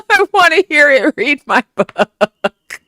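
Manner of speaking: words squeezed out mid-laugh, excited. Real, not acted. Streamer to chat, still cracking up and laughing. Laughing about Cockney e-reader idea.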